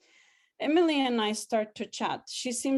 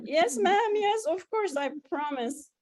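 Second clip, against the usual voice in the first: much higher and louder, bursting with joy; near-scream of delight; 9 semitones higher, 3 dB louder than usual. A narrator's reading. Student promising landlady, shrieking with delight. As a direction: squeal the yes like you can't contain it. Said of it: "I almost scream with delight".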